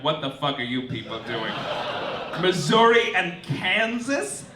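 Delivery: usual speaking voice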